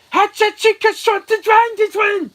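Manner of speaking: high, loud gibberish tirade; mock Chinese screaming. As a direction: imitate an angry scream in fake Chinese. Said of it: "screaming at her in Chinese language".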